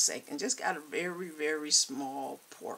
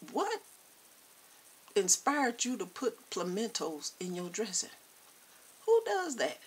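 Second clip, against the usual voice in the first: shocked question; 6 semitones higher than usual. Retold or imitated. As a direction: squeal it in disbelief at the pimentos. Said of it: "I'm like".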